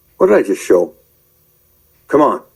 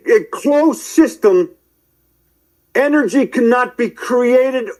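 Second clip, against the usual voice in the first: preachy lecture; 10 semitones higher than usual. Real, not acted. Lecturing scientists in preacher mode.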